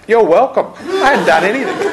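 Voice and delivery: giggling at my own silly reply; laughing at myself. Retold or imitated. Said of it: "I mindlessly said".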